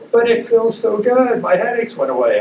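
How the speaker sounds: high, loud imitation of relief; delighted retold patient. Patient telling doctor her headaches went away, high excited imitation.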